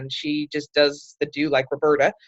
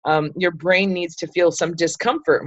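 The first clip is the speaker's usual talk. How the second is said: ordinary talk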